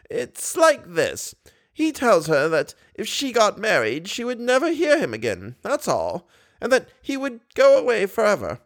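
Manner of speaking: put-on high, patient old lady explaining; as if it were the simplest thing. A narrator's reading. Old lady explains the angel to Raoul, high.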